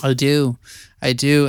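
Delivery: ordinary delivery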